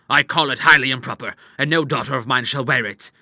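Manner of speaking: loud, indignant father; thundering disapproval. Acted. Father forbidding his daughter's dress, loud indignation. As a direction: lay down the law angrily. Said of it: "thundered the doctor".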